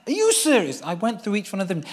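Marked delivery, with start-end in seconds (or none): 0.1-0.8 high, louder disbelieving question; pitched-up are you serious; incredulous. Retold or imitated. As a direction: press each boy, hardly believing it. Questioning boys one by one.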